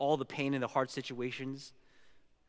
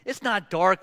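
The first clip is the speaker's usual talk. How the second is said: loud, higher protest; exasperated contradiction; 5 semitones higher, 10 dB louder than usual. Acted. Big Bear answering Little Bear's dark complaint, exasperated. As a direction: push back like a worn-out story parent.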